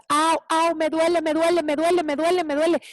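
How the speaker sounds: mock "it hurts" wail; acted pain. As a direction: act out yelping over a painful fall. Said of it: "te caes y te duele muchísimo".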